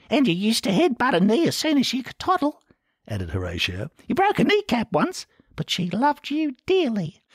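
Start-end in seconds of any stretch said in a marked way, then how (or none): 0.1-2.6 Horatio's voice. A narrator's reading. The narrator voicing Horatio to his brothers, high and meek. 4.1-7.2 Horatio's voice. A narrator's reading. The narrator voicing Horatio to his brothers, high and meek.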